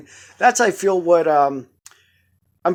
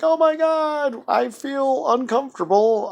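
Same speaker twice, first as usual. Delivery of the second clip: imagined self; 11 semitones higher than usual. Retold or imitated. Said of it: "and I'm like".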